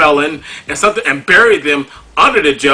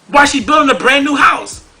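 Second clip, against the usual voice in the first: louder and higher, near shouting; heated rant; 11 semitones higher, 6 dB louder than usual. Real, not acted. Ranting at Cherie over her child support, loud and high. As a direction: yell it at her, get a life.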